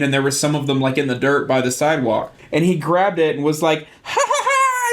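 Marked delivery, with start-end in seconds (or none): 4.0-4.9 very high mock kid laughter; gleeful toddler. Two-year-old chasing with a worm, high imitated glee. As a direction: imitate a little kid's mischievous giggle.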